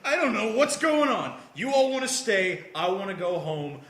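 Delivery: mock distraught whine; freaking out. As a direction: play an overwhelmed teenager wailing slowly. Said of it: "he's freaking out. He's like".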